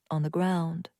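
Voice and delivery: usual speaking voice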